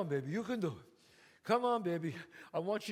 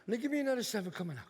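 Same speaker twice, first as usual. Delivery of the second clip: ordinary delivery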